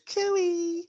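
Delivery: very high, sing-out cooey; high-pitched call. Acted. Game master voices Lily looking up, high-pitched call. Character looks up and calls out.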